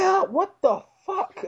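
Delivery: incredulous outburst. Retold or imitated. Man handed bags for his feet, retold incredulous outburst. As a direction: exclaim in high-pitched, amused dismay.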